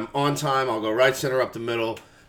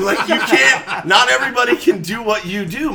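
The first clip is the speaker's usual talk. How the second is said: laughing incredulous protest; 8 semitones higher, 6 dB louder than usual. Retold or imitated. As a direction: play the player who can't believe his coach. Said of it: "And Boggs would be like".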